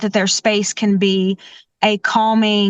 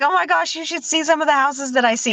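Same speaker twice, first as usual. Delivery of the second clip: higher, mock-astonished reply; amused disbelief; 6 semitones higher, faster than usual. Organizer retelling her reply to a tidy client, pitched-up mock astonishment. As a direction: play up your own disbelief, voice lifted. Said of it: "And I'm like".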